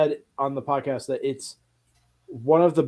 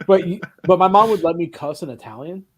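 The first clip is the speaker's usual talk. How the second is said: ordinary talk, nothing marked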